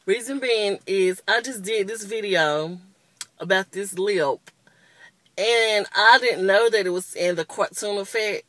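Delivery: ordinary talk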